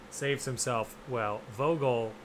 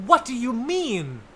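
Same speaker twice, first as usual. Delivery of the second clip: indignant disbelief; 10 semitones higher, 9 dB louder than usual. Real, not acted. Co-host defends an actor's accent to a friend, indignant. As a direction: challenge them, high and loud. Mock outrage at the criticism.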